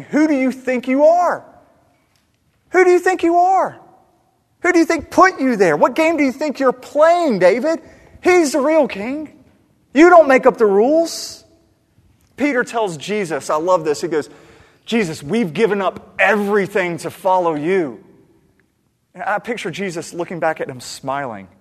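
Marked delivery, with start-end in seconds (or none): 0.0-11.4 thundering, high-pitched prophet's reprimand; outraged rebuke. Acted. Preacher voices prophet confronting the king. 14.8-18.0 loud, raised plea of a disciple; insistent protest. Acted. Voices Peter protesting to Jesus.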